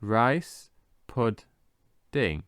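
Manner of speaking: RICE, PUD, DING with long pauses; syllable by syllable. Shown as a demonstration. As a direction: say "rice pudding" very slowly in three separate beats. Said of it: "And again".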